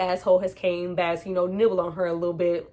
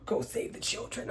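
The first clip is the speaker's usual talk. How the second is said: faint, breathy dying voice; dying whisper; 9 dB quieter than usual. Retold or imitated. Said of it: "he's like".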